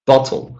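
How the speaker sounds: "bottle" stressed on BOT; stress on first syllable. Shown as a demonstration. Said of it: "let's stress the first".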